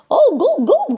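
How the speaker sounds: high, loud cartoonish goof; goofy. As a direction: hoot it like a silly cartoon character. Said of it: "Joey acts like this goofy little".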